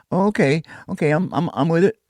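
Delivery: high eager woman's voice. Retold or imitated. Woman agreeing to the trip, high and eager. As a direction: pitch up, quick to agree.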